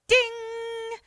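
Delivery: high, ringing held ding; toaster ding. Retold or imitated. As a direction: imitate the timer bell going off. Imitates toaster finishing.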